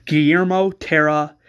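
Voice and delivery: usual speaking voice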